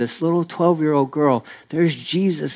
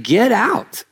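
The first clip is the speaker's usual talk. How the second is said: higher-pitched blunt order; acted eviction order; firm dismissal; 7 semitones higher than usual. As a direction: throw them out, nothing gentle. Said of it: "this isn't like, a, you know, gentle".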